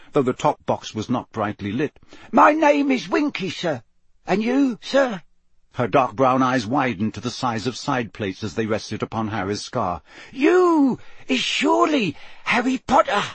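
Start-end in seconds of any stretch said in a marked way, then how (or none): 2.3-5.2 very high, tiny elf voice; squeaky house elf. A narrator's reading. House elf introduces herself to Harry, in a high squeak. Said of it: "a teeny quivering squeak of a voice". 10.3-13.2 very high, amazed house-elf voice; awestruck elf squeak. A narrator's reading. House elf recognises famous Harry, awestruck squeak. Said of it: "looking awestruck".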